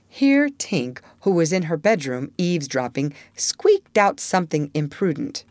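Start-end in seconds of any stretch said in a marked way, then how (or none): none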